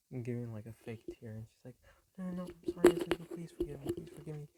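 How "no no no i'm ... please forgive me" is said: high and loud, tumbling apologies that fade as she calms; frantic pleading. Retold or imitated. Mother hugging her son after faking his tear: frantic loud pleading. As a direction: beg him to forgive you, overdone and near tears. Mom begging forgiveness, near tears.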